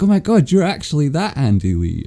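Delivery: excited. Retold or imitated. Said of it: "and we're like".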